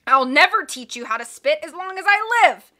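high, loud furious shout. A narrator's reading. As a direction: bellow it. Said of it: "he shouted".

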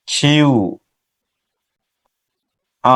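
ordinary talk, nothing marked

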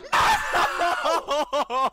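very loud, high burst of laughter; shrieking laugh. Real, not acted. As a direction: howl with laughter. Hit while saving Gary.

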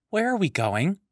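put-on young Matoran voice. Matoran pesters the Toa.

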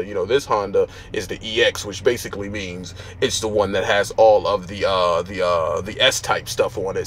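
mock a smooth-talking car dealer, lightly voiced; lightly voiced used car salesman pitching a Honda. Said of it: "a used car salesman".

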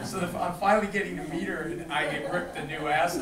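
ordinary delivery

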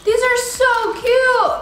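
high, slow squeal of delight; gushing. Real, not acted. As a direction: coo over something adorable. Gushing over the candy.

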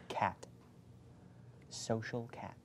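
slow, distinct whisper. Shown as a demonstration. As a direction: whisper each word clearly for a lip-reader. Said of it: "who's gonna whisper to me".

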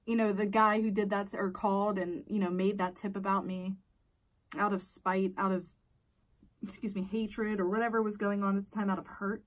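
normal talking voice

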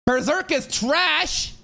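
high, slow hammed-up troll voice; mock hot take. Mocking a rage-bait commenter with a silly high voice. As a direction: squeal it like a ridiculous hot take. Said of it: "rather than just saying".

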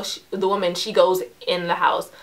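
ordinary delivery, nothing marked

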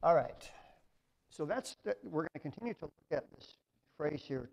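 ordinary talk

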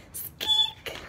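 very high, soft mouse squeak; tiny high squeak. Acted.

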